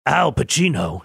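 a clumsy stab at Pacino; terrible Al Pacino. Retold or imitated. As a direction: botch an Al Pacino voice on purpose. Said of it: "That was a terrible Al Pacino".

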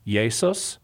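"Jesus" said Latin-style as YAY-sus; Latin pronunciation. Shown as a demonstration. Apologist tracing the name into Latin, Latin pronunciation. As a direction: say "Jesus" with a Y start and short ending, YAY-sus. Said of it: "it went into Latin as".

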